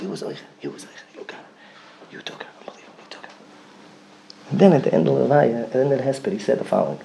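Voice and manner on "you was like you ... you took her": whispered, soft wonder; whispered awe. Retold or imitated. Father telling God you took her, whispered.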